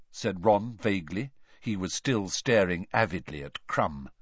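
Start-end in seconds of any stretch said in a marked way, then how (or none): none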